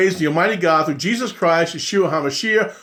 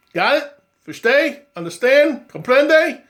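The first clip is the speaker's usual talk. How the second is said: playful check-ins; 7 semitones higher than usual. Pretend. Rapid multilingual check-ins.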